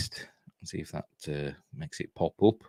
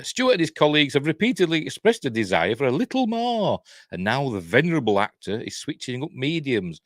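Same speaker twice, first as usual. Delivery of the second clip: raised, punchy news-reading voice; article read-out; 6 semitones higher, 4 dB louder than usual. Acted. Host reading viewers the Picard story, punchy read-out. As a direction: read it out loud and lively.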